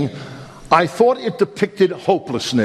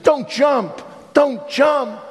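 higher urgent plea of a policeman; pleading cop; 7 semitones higher, much slower than usual. Retold or imitated. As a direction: call out to stop him jumping. Policeman pleading in sermon story.

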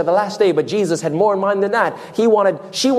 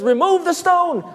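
commanding Jesus; 11 semitones higher than usual. Retold or imitated. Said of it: "Jesus says".

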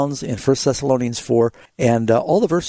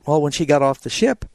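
ordinary delivery

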